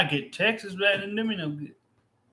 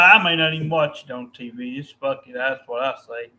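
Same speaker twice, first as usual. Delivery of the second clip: ordinary talk, nothing marked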